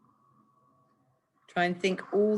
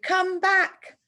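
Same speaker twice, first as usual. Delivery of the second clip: a much higher, louder shout; 13 semitones higher, 4 dB louder than usual. Acted. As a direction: yell it after someone running away. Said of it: "the policeman shouted".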